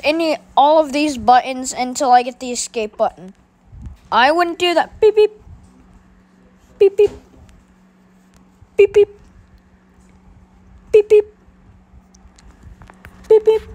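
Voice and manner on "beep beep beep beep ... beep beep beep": sharp robotic beeps, high and loud; high electronic beeping. Acted. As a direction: beep like a machine arming. Imitating weapon sound.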